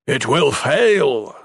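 slow, loud warning yell. Acted. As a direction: hammer each word out loud. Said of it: "Kaimas yelled".

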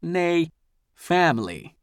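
put-on kindly old leader correcting himself fondly; a grandfather gathering his family; grandfatherly voice. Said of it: "in his very best grandfather voice".

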